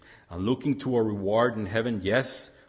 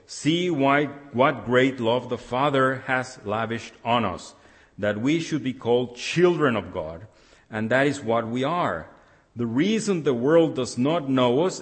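raised, measured reading voice; scripture reading; 3 semitones higher, 3 dB louder than usual. Acted. Pastor reading First John to the congregation, measured and raised. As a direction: read the verses out to the church with weight.

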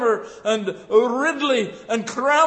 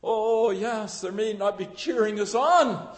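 usual speaking voice

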